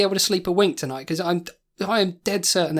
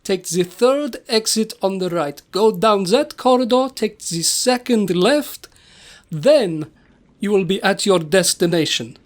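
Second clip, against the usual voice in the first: measured, hammy Transylvanian host voice; Transylvanian accent. Acted. Castle host directing guests to their bedroom, Transylvanian accent. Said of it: "that is a Transylvanian accent".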